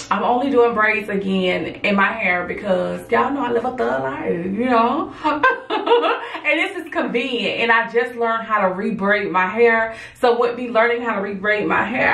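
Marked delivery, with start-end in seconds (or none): 5.2-6.4 bursting into a high laugh. Real, not acted. Laughs at thug life joke.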